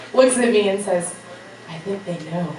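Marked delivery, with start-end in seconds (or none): 1.7-2.5 low, hushed imitation of a friend; retold quiet aside to a friend; confiding undertone. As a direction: murmur it like a secret warning. Friend confides teachers have found out.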